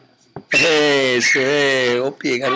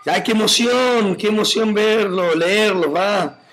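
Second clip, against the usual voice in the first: excited; 7 semitones higher than usual. Real, not acted. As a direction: savour each word as if moved. Emoción al leer mensajes. (emocionada, más aguda y lenta)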